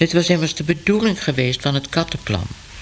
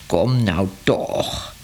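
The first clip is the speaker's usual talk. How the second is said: much lower, very slow coaxing tomcat; drawn-out tomcat coaxing her outside; coaxing cat; 7 semitones lower, much slower than usual. Acted. Cat coaxing Minoes to go out to her sister, low and slow.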